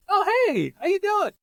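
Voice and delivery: very high, cheery hello; excited greeting. Retold or imitated. Patient greeting his old therapist, excited greeting. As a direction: call out a happy high-pitched hello.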